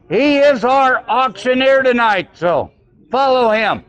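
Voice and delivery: ordinary delivery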